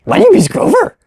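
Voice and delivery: squeaky go at Grover; lightly voiced Muppet attempt; Grover impression. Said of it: "The next one we have is gonna be Grover".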